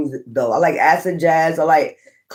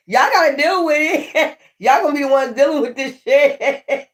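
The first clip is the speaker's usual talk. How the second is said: laughing, higher; 8 semitones higher than usual. Real, not acted. Laughing while teasing listeners.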